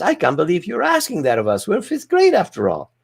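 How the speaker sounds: little Susie protesting. Retold or imitated. Little Susie answering the teacher, mock-outraged girl voice. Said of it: "little Susie jumps up and says".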